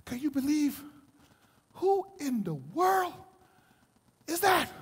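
halting, with pauses of disbelief; stunned disciples. Retold or imitated. Preacher as disciples after the wind stops, hushed amazement. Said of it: "watch the disciples".

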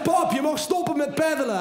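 mock calling out. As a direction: call to dad across the water. Son calling to father.

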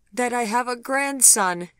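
high and loud, bursting out; blurted out. A narrator's reading. Grandmother confessing to Gregory, loud high blurt. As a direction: finally blurt the secret out. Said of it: "she finally blurted out".

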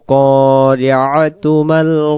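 slow melodic tilawat, long drawn ওয়ামা; slow recitation. Shown as a demonstration. Teacher recites the full verse for students, slow and melodic. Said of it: "একসাথে কিভাবে পড়ব".

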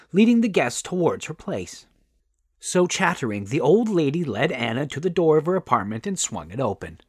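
plain reading voice

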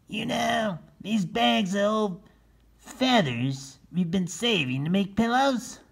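high, slow scheming turkey. Acted. Solomon asking his friends about the feather bags. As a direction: lay out the plan slowly. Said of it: "Lavinia and Alfred nodded".

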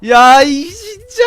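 high, slow, stretched-out shout; shocked yell. Real, not acted. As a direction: yell in real disbelief. Shocked by hidden enemies.

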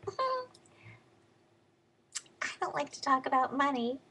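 lightly mock a coy heiress. Quoting [name]'s reply.

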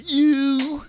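high squeaky granny voice; little old lady voice. Retold or imitated. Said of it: "like a little old lady".

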